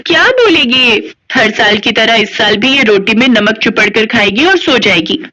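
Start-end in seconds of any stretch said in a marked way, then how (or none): 0.1-1.1 slow, high sneering drawl; taunting. Acted. Girl mocking silent Radha, slow high sneer. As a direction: drag it out to mock her.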